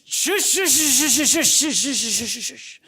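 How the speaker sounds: drawn-out shushing to hush someone; a rhythm of loud, insistent shushes; soothing shush. Acted. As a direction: shush them like a fussy baby. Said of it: "You just need to sh".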